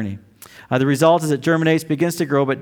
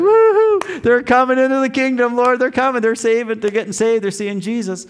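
much higher, louder whooping celebration; jubilant cheer; 11 semitones higher, 4 dB louder than usual. Retold or imitated. Young evangelist praying over converts, whooping excited cheer. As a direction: cheer like souls are pouring in. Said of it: "It's like".

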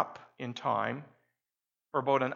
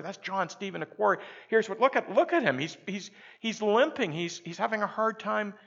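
excited crowd chatter; 7 semitones higher, 3 dB louder than usual. Retold or imitated. Crowd whispering about limping runner.

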